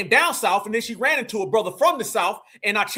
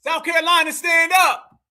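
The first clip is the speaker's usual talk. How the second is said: higher, louder shout-out to South Carolina; call-out; 6 semitones higher, 3 dB louder than usual. Real, not acted. Host shouting out South Carolina. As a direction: hype up the home state.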